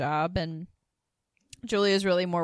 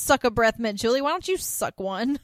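higher and louder mock sass; sassy retort; 6 semitones higher, 6 dB louder than usual. Suzanne snapping back at Julia, in higher, louder mock sass. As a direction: snap it back at her. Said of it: "she's like".

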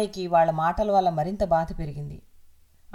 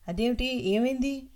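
distressed; 6 semitones higher than usual. Acted. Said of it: "అంది దుఃఖంగా".

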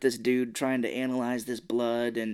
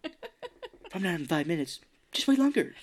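higher-pitched comic movie-quote voice; imitating a zany film comedian; Ace Ventura; 5 semitones higher than usual. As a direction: do a goofy movie impression, pitch jumping up at the end. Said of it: "Ace Ventura".

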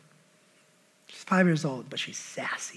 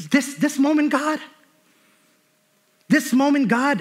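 anguished cry to God; 9 semitones higher, 4 dB louder than usual. Retold or imitated. Anguished prayer to God, retold.

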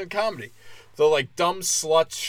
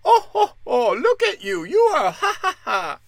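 much higher, braying fake laughter; mock cackling heckler; 13 semitones higher than usual. Women mocking the heckler's shrill cackling. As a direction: cackle like an obnoxious drunk fan. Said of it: "And you're over here".

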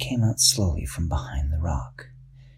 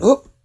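sudden oop; 9 dB louder than usual. Acted. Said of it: "He went".

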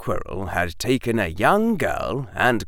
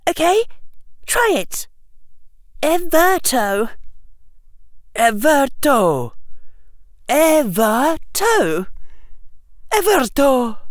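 put-on girl tutor demonstrating; 4 dB louder than usual. Hermione drills classmates on a spell, high and deliberate.

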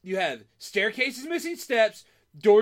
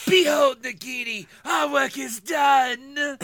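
slow, loud Fierstein-style Voldemort; Voldemort as Harvey Fierstein; 5 semitones higher, 5 dB louder, slower than usual. Acted. Host hams up Voldemort in Harvey Fierstein voice. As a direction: boom it out like a gravel-voiced dark lord. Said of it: "my Voldy Firestein".

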